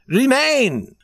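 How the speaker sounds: high, loud princely order; powerful command. A narrator's reading. Prince orders the young man to stay, powerful command. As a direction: call it out with authority. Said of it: "commanded Prince Michael. His voice was so powerful".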